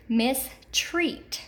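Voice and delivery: "mistreat" said slowly, weight on "treat"; stressed second syllable. Shown as a demonstration. Teacher giving the word, "mistreat" slowly stressed on the second syllable. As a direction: separate "mistreat" and lean on its long E. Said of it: "accented in the second".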